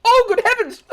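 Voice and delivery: high, loud startled toff; a posh gentleman's startled cry; posh shriek. Acted. Gamer shrieking like a startled posh gentleman.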